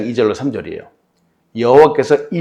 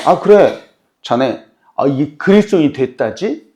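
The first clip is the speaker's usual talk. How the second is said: mocking, teasing questions, pitched up; sarcastic taunt; 3 semitones higher, 4 dB louder than usual. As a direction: sneer at the believing friend. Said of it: "비꼬면서 말하는 거예요".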